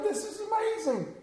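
amazed. Retold or imitated. Awed at free grill samples.

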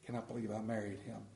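wife's exasperated aside; wife's look. Retold or imitated. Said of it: "see her look at me with that look".

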